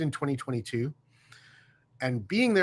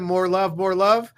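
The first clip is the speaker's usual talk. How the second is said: joyful reply; 5 semitones higher, 6 dB louder, slower than usual. Retold or imitated. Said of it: "all three of us said back to him".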